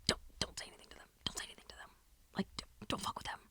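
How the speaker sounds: hushed, fast whispered warning; breathless whispered plea not to say anything; urgent whisper. Retold or imitated. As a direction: whisper it so the guys won't hear. Host warning backstage.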